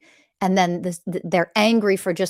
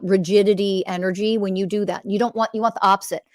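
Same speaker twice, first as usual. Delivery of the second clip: ordinary talk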